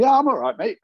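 high, loud breezy mock of the doctor. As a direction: toss it off like a chummy doctor. Mimicking doctor's casual reply.

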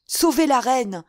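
high, loud plea for help; urgent cry. A narrator's reading. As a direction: cry out to save someone. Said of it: "s'écria Mazarin en s'adressant au coadjuteur".

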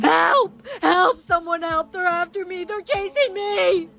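panicked calls for help; crying out, scared and fleeing; frightened cries for help. Acted. Fleeing someone in a horror scene.

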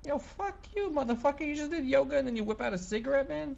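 high outraged yell. Acted. Mock outrage at friend smoking.

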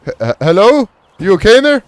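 high, loud, stuttered call. Pretend. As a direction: call out to check on someone next door. Calls out to the neighbor.

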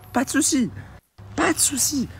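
very high, sweet "pas de souci"; sing-song politeness. Pretend. An obliging agent cheerfully agreeing, high sing-song. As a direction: chirp it like an obliging clerk. Ideal polite reply imagined.

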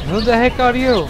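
high startled question; startled by a stranger; surprised. Real, not acted. Surprised by new character.

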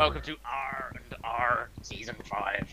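slow, drawn-out pirate welcome; mock pirate announcement, slow; pirate talk. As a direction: lay it on thick like a pirate captain greeting the crew. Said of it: "a lot of pirate talk".

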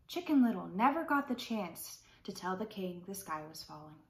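plain reading voice

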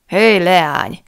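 rowdy shout from below; shouted greeting. A narrator's reading. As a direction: yell up to the girl on the gallery. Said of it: "kiáltott oda Rupert".

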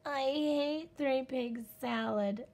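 high, slow moaning complaint. Acted. Little wolf hating dinner, slow moaning. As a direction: sulk it out long and miserable. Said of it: "he moaned".

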